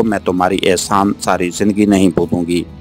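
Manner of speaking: ordinary delivery, nothing marked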